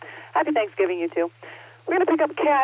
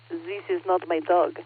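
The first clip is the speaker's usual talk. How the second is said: put-on Peter Sellers style French accent, lightly done; imitating a comic French inspector accent on zis and dog; 4 semitones lower, 5 dB quieter than usual. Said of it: "he tends to use the French accent made popular by Peter Sellers".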